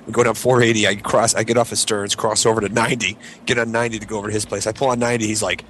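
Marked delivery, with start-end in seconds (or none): none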